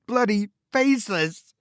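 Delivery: plain reading voice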